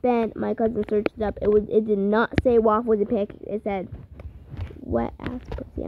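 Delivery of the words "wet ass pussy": whispered. Real, not acted. Embarrassed lowered explicit words.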